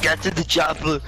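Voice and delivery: Arnold Schwarzenegger impression. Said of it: "Arnold Schwarzenegger".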